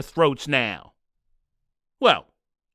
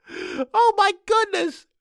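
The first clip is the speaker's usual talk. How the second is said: much higher, slow, dragged-out disbelief; exasperated; 13 semitones higher, slower than usual. Acted. Host groaning at the audience, high and drawn out in disbelief. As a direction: stretch it out in mock despair.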